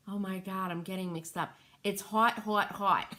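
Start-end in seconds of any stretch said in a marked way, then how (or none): 1.8-3.1 imitating Cheryl's accent. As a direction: echo Cheryl's accent for fun. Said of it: "Cheryl was saying with her accent".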